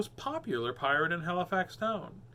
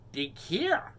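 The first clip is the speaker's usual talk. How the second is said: mermaid instruction; 3 semitones higher than usual. Acted. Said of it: "she said".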